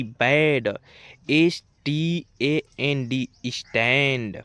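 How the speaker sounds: slow, loud letter-by-letter spelling, then the word; spelling out and reading words. Shown as a demonstration. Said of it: "एक एक word को यहाँ पर पढ़ते हैं". (धीरे-धीरे ज़ोर से अक्षर बोलकर फिर पूरा शब्द पढ़ना)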